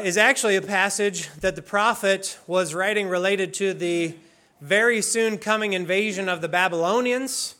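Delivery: usual speaking voice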